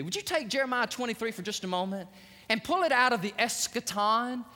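ordinary delivery

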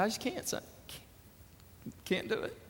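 breaking, near-whispered goodbye; a mother too upset to say it aloud; choked-up mother. Retold or imitated. Mother at the kitchen, too emotional for goodbye, broken whisper. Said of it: "Mom was so emotional".

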